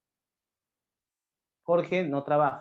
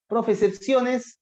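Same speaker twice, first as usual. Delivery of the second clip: lightly voiced student, higher and slow; a hint of a pupil, pitched up; imagined student's query; 6 semitones higher, 3 dB louder, slower than usual. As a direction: raise it slowly like a pupil querying. Teacher voices a student's question.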